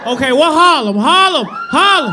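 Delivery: loud crowd call of Harlem. Real, not acted. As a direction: hype up the Harlem section of the crowd. Calling out to the crowd.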